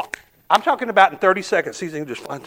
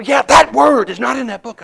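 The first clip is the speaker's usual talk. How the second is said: loud, high furious yell; raging shout; 8 semitones higher, 10 dB louder than usual. Retold or imitated. Inmate shouts at the preacher, raging. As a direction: shout him down. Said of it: "he's raging".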